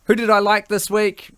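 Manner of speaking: high, teasing rundown voice; playful game-show build-up. Acted. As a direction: tease it like an awards host. Mock awards rundown.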